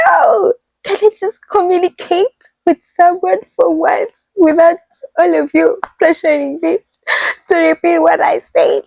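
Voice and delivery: high-pitched, pleading protest; exasperated outburst. Retold or imitated. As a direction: act out being fed up with the pressure. Acts out exasperated reply to listeners.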